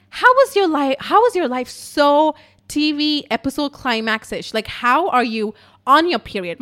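loud, high imitation of a stunned friend; incredulous friend. Friend reacts to wild story, loud mock incredulity. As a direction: exclaim it in exaggerated disbelief.

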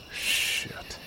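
whispered, under the breath; muttered curse. A narrator's reading. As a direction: swear quietly to yourself. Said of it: "I muttered to myself".

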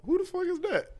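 very high, squeaky animal voice; mountain lion. Retold or imitated. Host voicing the mountain lion spotting hikers, very high-pitched. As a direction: voice the lion in a tiny falsetto. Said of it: "and he says".

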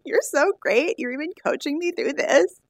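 high-pitched, admiring reassurance; retold gushing praise.

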